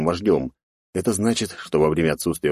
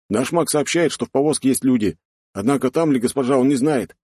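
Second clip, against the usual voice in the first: higher-pitched soldier's report; reporting warrior; 8 semitones higher than usual. Acted. Warrior reports on the wagon to his lord, higher voice.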